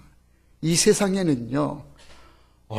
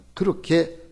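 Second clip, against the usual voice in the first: ordinary delivery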